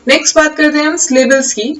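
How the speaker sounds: normal talking voice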